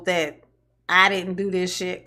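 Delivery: ordinary delivery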